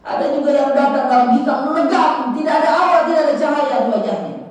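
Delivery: high, loud sermon warning; impassioned preaching. Acted. Preacher warns of dark faces.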